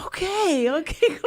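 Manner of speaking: high meek okay okay; kids giving in. Retold or imitated. The kids agreeing to stop, high and meek. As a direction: give in like a chastened kid. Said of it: "And they go like".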